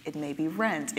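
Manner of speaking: usual speaking voice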